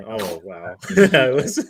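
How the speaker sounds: ordinary talk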